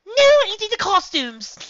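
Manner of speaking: high, loud, drawn-out scolding; firm order. A narrator's reading. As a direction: put your foot down at full volume. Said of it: "Reggie said firmly".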